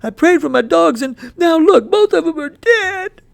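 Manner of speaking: put-on high, loud, breaking boy's voice; boy sobbing over his dead dogs; grieving boy. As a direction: cry it out to mama in protest. Boy rejects prayer.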